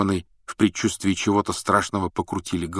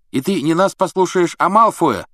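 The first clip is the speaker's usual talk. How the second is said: angry; 11 semitones higher, 7 dB louder than usual. Acted. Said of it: "рассердился Рон".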